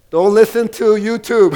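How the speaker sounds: loud, high preacher warning. Real, not acted. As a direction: bark the warning to the room.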